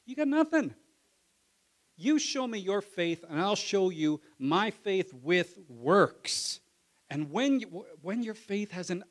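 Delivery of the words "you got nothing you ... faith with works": high, loud scornful retort; James retorting. Retold or imitated. James answering a boastful believer, loud and scornful. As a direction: cut the boaster down. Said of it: "And he goes".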